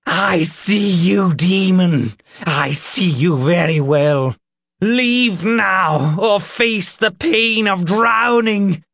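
high, loud fanatical threat; shouted exorcism, drawn out and furious; possessed raving. A narrator's reading. Reverend threatens the demon in Nick, shouting like one possessed. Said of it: "the Reverend was the one possessed".

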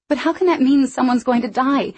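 high, loud desperate plea. Acted. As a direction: beg for an answer. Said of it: "I said desperately".

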